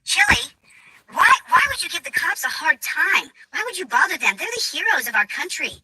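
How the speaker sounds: high, pleading mock reproach; put-on voice of an indignant police fan; imitating a reproachful woman. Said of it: "She just ends up going".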